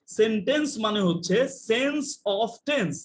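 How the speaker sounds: slow, deliberate, stress on "tense"; slow emphatic answer. Shown as a demonstration. Teacher answering own question, slowly and firmly. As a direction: lay it down slowly like a rule. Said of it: "sentence মানে কি?".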